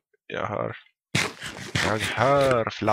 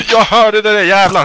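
much higher, louder startled outburst; real jolt of surprise, blurt it; startled shout; 10 semitones higher, 14 dB louder than usual. Real, not acted. Startled by sound in cave. (förskräckt utrop)

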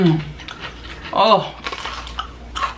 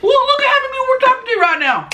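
mock high quote; much faster than usual. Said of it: "I'm like".

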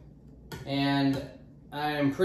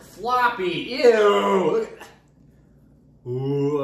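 disgusted; 5 semitones higher, 6 dB louder, slower than usual. Real, not acted. Sees slimy melon flesh.

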